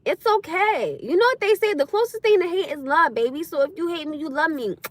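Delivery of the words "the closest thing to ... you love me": ordinary talk, nothing marked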